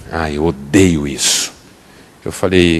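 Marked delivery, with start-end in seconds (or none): 0.1-1.5 low, slow annoyed grumble; grumbling wife. Retold or imitated. Pastor imitating his wife admitting the slip, a slow sulky grumble. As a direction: sulk it out. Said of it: "ela fez assim".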